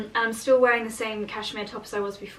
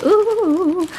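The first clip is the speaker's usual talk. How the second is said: delighted ooh; 9 semitones higher, 7 dB louder than usual. Real, not acted. Admiring the candle bottle.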